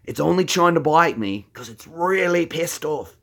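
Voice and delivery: high-pitched Australian wildlife host; gleeful broad Aussie showman; hammed-up croc-hunter excitement; Steve Irwin. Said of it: "that's Steve Irwin".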